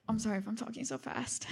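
hushed, near-whispered apology; quick embarrassed murmur under the breath; apologetic aside. Real, not acted. Embarrassed aside during her testimony.

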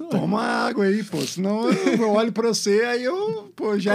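voz embargada, meio rindo; segurando o choro. Real, not acted. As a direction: tenta não chorar enquanto fala. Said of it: "já é difícil pra mim falar". (embargado, pede ao amigo que pare de chorar)

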